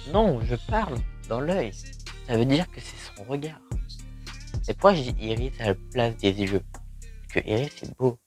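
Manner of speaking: ordinary delivery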